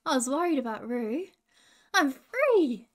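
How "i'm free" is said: high, loud cry of release; joyful yell. A narrator's reading. Roo's tail comes out from the branch, triumphant yell. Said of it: "yelled Roo".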